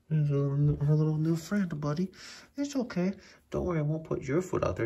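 pet talk. Real, not acted. Owner reassures cat about its food, high cooing.

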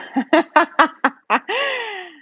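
loud, high cackling laugh; burst of laughter. Real, not acted. Painter teasing viewers about Kim, bursting out laughing. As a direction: crack up in a long, high laugh.

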